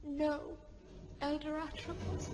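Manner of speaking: cowed reply. Acted. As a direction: barely voice it, beaten down. Cowed after the elder's threat.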